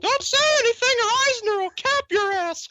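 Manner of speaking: high squeaky cartoon mouse; Mickey Mouse voice. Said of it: "the voice of Mickey".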